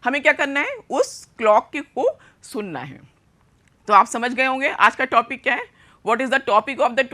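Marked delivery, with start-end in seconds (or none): none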